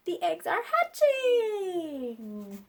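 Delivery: high, slow and drawn out; slow drawn-out announcement. Acted. Piggy tells Gerald the news, slow drawn-out. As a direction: stretch it with wonder.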